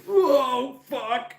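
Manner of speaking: high startled whoa after hitting the door; startled yelp. Retold or imitated. Retelling the door cutting his nose, high startled yelp. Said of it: "I was like".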